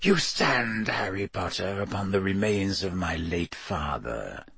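soft, cold villain, sinking low; soft hiss. A narrator's reading. Dark lord addresses his captive over a grave, hissing softly. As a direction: hiss it softly, letting the voice drop. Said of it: "he hissed softly".

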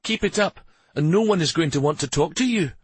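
high-pitched scolding warning; cross woman laying down a warning; snapping. A narrator's reading. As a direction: scold with rising exasperation. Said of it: "Shari snapped".

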